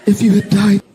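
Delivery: high, loud mock sobbing; hammed-up crying, starting breathy and rising loud; imitated crying wife. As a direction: wail it as a tearful wife. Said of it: "And she's crying".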